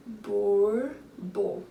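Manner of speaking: "Borboleta" split as bor, bo, le, ta with gaps; slow, separated "Borboleta"; syllable by syllable. Shown as a demonstration. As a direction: count out four beats in "Borboleta". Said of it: "Quatro sílabas".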